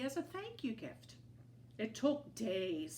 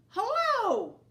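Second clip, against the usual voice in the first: big high shouted greeting; loud friendly hail across the yard; shouted hello; 12 dB louder than usual. A narrator's reading. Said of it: "shouted a tortoise".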